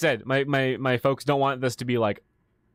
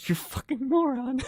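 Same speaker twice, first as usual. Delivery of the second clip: much higher, slow mock scorn; higher, slowed mock disbelief; mock group disgust; 12 semitones higher than usual. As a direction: voice the whole table's silent disbelief. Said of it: "everyone is like".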